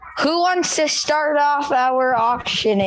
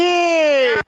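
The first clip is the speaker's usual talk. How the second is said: high, falling shouted response; answering cheer; 4 semitones higher than usual. Real, not acted. Crowd answers the leader's acclamation, shouted cheer.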